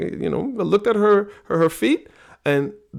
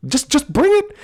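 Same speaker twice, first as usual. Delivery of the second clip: high, loud taunt, much higher and louder; mock taunt; 7 dB louder than usual. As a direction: goad her loudly in a high voice. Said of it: "my wife is just sitting there like".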